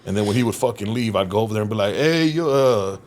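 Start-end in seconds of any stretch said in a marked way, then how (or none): 1.9-3.0 slow, higher, broken Spanish-English mix. Retold or imitated. Translating the foreman's orders for the crew in broken Spanish and English. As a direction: fumble between Spanish and English. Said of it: "like fucking broken Spanish and English".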